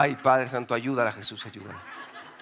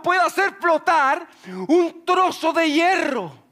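higher, louder, slowing, full of wonder; amazed shout; 11 semitones higher, 3 dB louder than usual. Real, not acted. Preacher challenges the congregation, shouting in amazement. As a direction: preach the disbelief at full force.

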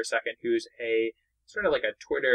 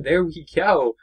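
real excited shout, louder; excited cheer; 5 semitones higher, 7 dB louder, slower than usual. Real, not acted. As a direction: cheer the kill out loud. Scoring a kill in the game.

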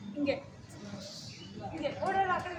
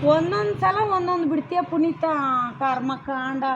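exasperated scolding; 2 semitones lower, 7 dB louder than usual. Real, not acted. Director scolds actor Puneeth.

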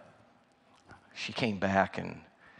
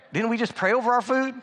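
high, fast, puzzled question; mock disbelief, pitched up; imitating a confused buddy; baffled friend; 10 semitones higher, 3 dB louder, faster than usual. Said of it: "Nick is looking at me like".

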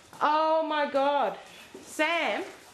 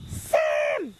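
shocked scolding; 7 semitones higher than usual. Real, not acted. Finds dog chewed her gumboot.

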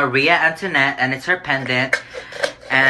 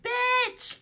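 excited squeal; 9 dB quieter than usual. Real, not acted. Reacting to colourful pearls.

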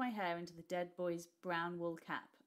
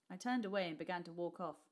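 usual speaking voice

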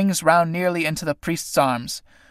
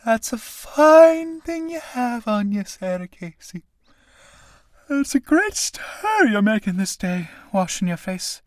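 put-on bland, half-awake old woman teasing; 6 semitones higher than usual.

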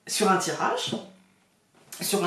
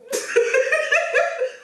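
rire haut qui retombe; éclate de rire; rire aigu. Real, not acted. (rire aigu)

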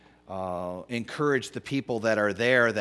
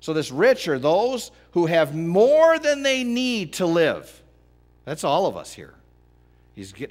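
high, loud, driving sermon; impassioned pulpit preaching; full-force preaching; 7 semitones higher, 6 dB louder than usual. Acted. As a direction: preach it hard to the congregation.

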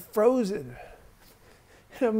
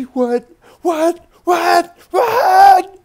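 shrieked disbelief, building louder and higher; screaming; 11 semitones higher, 16 dB louder, much slower than usual. Retold or imitated. Mother hearing the news, screaming. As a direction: scream each word harder than the last. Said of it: "She starts screaming".

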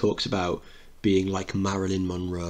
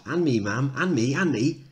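put-on higher kids' voices joining in; chorus of small kids, higher; children chiming in; 6 semitones higher than usual. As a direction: several children piping up. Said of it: "Kids off".

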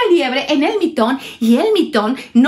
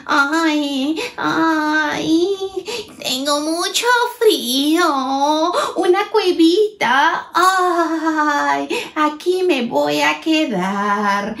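higher, slower voice of a cold mole; shivering mole; 4 semitones higher, slower than usual. Acted. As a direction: sound like a chilly little mole. El topo tiene frío. (topo friolento con voz aguda y lenta)

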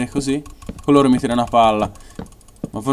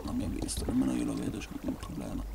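whispering; 16 dB quieter than usual. Real, not acted. Hiding from enemy.

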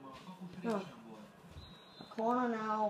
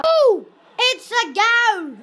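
sudden high, loud, drawn-out goal shout; excited goal cry; 8 semitones higher, 13 dB louder, slower than usual. Real, not acted. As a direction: yell it as the ball hits the net. Goal scored in football game.

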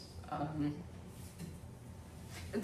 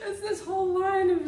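high, tearful laughing voice; words squeezed through real laughter; helpless laughter; 10 semitones higher than usual. Real, not acted. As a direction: try to explain while cracking up. Laughing while describing a picture.

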